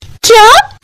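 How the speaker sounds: very high rising shriek of disbelief; outraged shock. Acted. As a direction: gasp it out high, scandalised. Wife outraged by weight remark.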